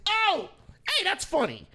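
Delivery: high-pitched audience exclamation; mock delighted realization. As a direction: pipe up like a crowd catching the joke. Imitating an audience suddenly amused.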